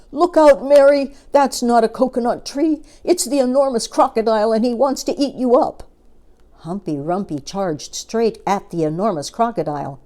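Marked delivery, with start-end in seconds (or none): 0.1-5.8 much higher, louder panicked warning; urgent shout. Acted. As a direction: shout to save her, fast toward the end. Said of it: "shouted Humpy Rumpy".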